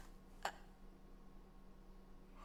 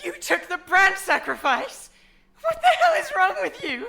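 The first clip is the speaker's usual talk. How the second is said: much higher, louder, with a laugh; exasperated outburst; 8 semitones higher, 5 dB louder than usual. Acted. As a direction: shriek at her in disbelief. Scolding friend in shock.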